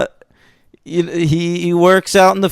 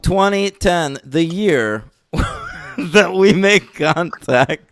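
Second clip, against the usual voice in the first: slow title read cracking into laughter; can't say without laughing; 2 semitones higher, much slower than usual. Real, not acted. Host introduces the film title, breaking into real laughter. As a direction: announce the title, then lose it laughing. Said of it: "can't even say without laughing".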